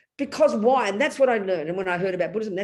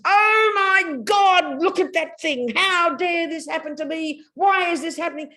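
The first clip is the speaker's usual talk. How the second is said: a hint of mock outrage, drawn out at first; mock outraged anger; 7 semitones higher, 4 dB louder than usual. Teacher voicing anger's inner cry of outrage to students. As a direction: act out the outraged, self-pitying mind. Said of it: "anger is".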